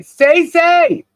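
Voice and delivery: high, loud happy holler; playful high-pitched shout-out; shouting a name out. Real, not acted. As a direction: belt the name out like a greeting. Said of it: "just me shouting your name out".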